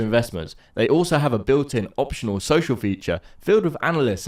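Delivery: ad read. Acted. Sponsor ad read.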